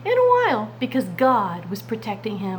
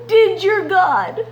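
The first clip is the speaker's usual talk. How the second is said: high, loud tearful cry, slow; king shouting into the den, near tears; 10 semitones higher, 6 dB louder, slower than usual. Acted. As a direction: call into the pit with desperate hope. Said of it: "And he shouted".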